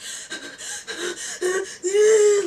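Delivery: high, loud imitated sobbing wail; mock shaky crying. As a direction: act out a nervous crying fit. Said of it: "I start crying and I just start shaking".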